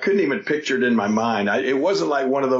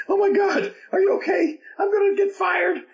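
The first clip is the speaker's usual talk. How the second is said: very high, near-crying panicked voice; panicked intern. Retold or imitated. Imitating the panicking WWE helper, high near-tears. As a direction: squeal it like you're about to lose your job. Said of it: "guy ... is panicking. I thought he was gonna start crying".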